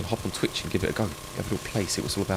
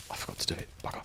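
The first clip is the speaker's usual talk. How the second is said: whisper; 12 dB quieter, much faster than usual. Real, not acted. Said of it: "whispered aside".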